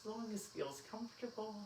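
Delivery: guided meditation. Acted. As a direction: guide them gently into stillness. Leads final relaxation.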